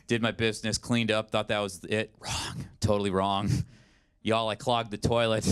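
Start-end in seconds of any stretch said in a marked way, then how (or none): none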